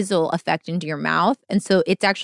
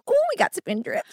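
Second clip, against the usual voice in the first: excited squeal. Retold or imitated. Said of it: "we're like".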